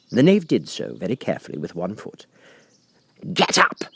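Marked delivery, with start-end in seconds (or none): 3.3-3.8 higher, sharp order; shrill Queen. A narrator's reading. The Queen to the gardeners, a shrill loud order. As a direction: bark it at the gardeners. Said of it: "in a shrill, loud voice".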